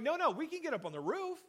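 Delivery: high, eager insistence; bright-eyed can-do protest; eager friends. Retold or imitated. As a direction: pitch it up like a keen friend who won't quit. Friends insisting on roof route.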